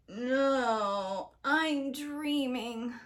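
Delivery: put-on slow drowsy boy voice; sleepy Jack. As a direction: mumble it half asleep.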